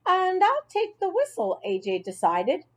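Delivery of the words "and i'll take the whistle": put-on light, high kid voice; high child voice. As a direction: play a young kid, bright and high. Child character voice.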